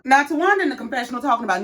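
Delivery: ordinary delivery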